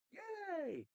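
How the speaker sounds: thin, high-pitched drawn-out "yay"; mock cheer. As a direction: cheer in a squeaky, put-on voice. Mock cheer about new character.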